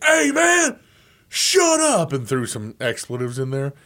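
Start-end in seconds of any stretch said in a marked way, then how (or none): 0.0-2.1 high, loud angry coach; mock coach yell. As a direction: snap at the noisy team. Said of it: "He's like".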